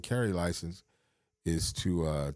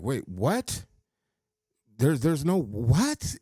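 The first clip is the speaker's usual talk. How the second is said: stunned disbelief; 6 semitones higher, 4 dB louder, slower than usual. Retold or imitated.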